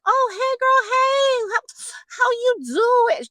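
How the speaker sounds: very high squealed greeting; over-the-top high-pitched gushing; gushing old friends. Acted. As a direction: squeal hello like long-lost girlfriends. Acts old friends greeting her.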